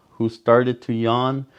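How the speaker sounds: ordinary delivery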